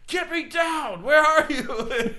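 high, loud, slow pleading; a lost old man calling from up high; hammed-up stranded old wrestler. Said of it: "he's just like".

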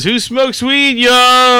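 mock crowd-work yell; mock hype comic. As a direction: shout it like a cheesy club comic opener. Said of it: "the same dude that comes in and says".

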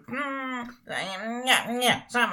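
ordinary talk

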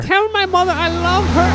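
ordinary talk, nothing marked

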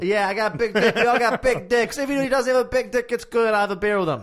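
high, loud mock bar-crowd boasting; rowdy bar patron. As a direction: holler along like a bar regular. Acts out rowdy bar crowd cheering.

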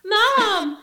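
mock a kid whining for mom over a mic; mock announcer calling mom. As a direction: ride operator mocking the child on the boat microphone. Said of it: "the announcer guy ... started going".

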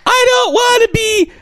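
very high mock wail; squeaky childish protest; hammed-up tantrum in a tiny voice; imitated whining partner. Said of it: "She's like".

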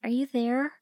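high, hopeful rising question; pitifully tentative; pathetic, timid. A narrator's reading. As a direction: ask meekly, lifting right up at the end. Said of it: "I sounded so pathetic".

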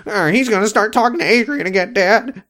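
put-on high, whining kid voice; whiny tattling son. [name] telling on Ricky to Dad, high whiny tattle. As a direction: tattle like a bratty child.

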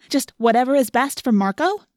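indignant, pitch climbing; outraged demand. A narrator's reading. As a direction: throw it as an accusation. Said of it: "demanded, outraged".

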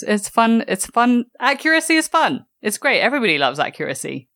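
high, bright mock enthusiasm; sarcastic. Real, not acted. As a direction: gush sarcastically over accuracy. Mocking accent accuracy debates.

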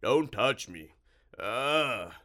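loud, repelled creature voice; revolted monster. A narrator's reading. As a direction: cry out in loud disgust. Monster recoils from touch.

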